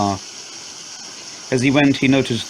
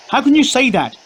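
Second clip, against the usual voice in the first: high, louder yell of disbelief; 14 semitones higher, 3 dB louder than usual. Acted. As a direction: throw it back at him, yelling. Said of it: "she yelled".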